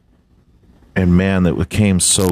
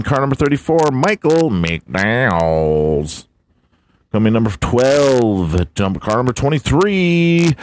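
hammed-up race announcer; 6 semitones higher than usual. Reading the NASCAR top finishers to his buddy, hyped announcer. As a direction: boom out the countdown like a race caller. Hams up the race results countdown.

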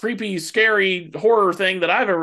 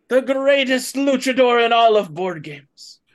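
luchador persona; 4 semitones higher than usual. Acted. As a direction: hype it up like a luchador MC. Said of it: "as El Mipo Negro".

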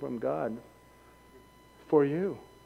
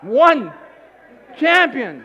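calling out; 9 semitones higher, 11 dB louder, much slower than usual. Real, not acted. Calling out champions.

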